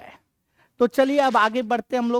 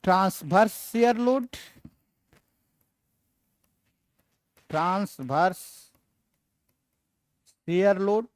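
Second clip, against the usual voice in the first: measured dictation with long pauses; dictating. Real, not acted. Teacher to students writing the load types down, dictating. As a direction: say it in pieces, as if writing along.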